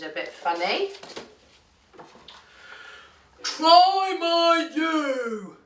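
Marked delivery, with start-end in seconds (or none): 3.4-5.6 very slow, loud, high-swooping bellow; bellowing bull. A narrator's reading. Bull offering the duck his letter, long loud bellow. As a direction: bellow it out with long mooing vowels. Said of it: "bellowed the bull".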